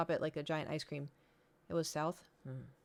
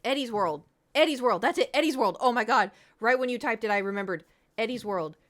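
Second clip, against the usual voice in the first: loud, higher burst of recognition; excited remembering; 4 semitones higher, 11 dB louder than usual. Real, not acted. Streamer suddenly recalls the place name, loud and excited. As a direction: blurt the name as it finally comes back.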